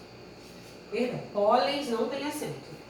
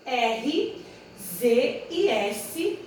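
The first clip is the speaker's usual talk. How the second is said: slow spelling; much slower than usual. Shown as a demonstration. Teacher spelling out letters slowly.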